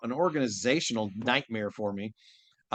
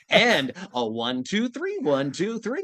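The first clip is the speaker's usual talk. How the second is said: Koro Sensei counting waltz; 7 semitones higher than usual. Pretend. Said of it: "in Koro Sensei's voice".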